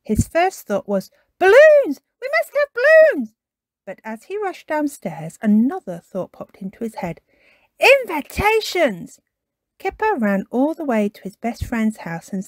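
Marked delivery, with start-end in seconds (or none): 1.4-3.3 much higher, louder cry of delight; birthday puppy bursting with excitement; excited shout. Acted. Kipper wakes on birthday. 7.8-9.2 higher, slow alarmed cry; sudden remembering. Acted. Kipper remembers invitations.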